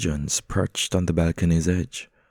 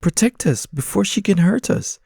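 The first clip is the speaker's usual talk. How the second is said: urgent pigeons; 13 semitones higher, 6 dB louder than usual. Acted. Pigeons urge attack.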